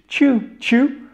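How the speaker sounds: high, isolated British CHOO for Tu; British English pronunciation. Shown as a demonstration. Teacher isolating the syllable, British English Tu as CHOO. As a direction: pipe Tu as a British chew. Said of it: "en British English".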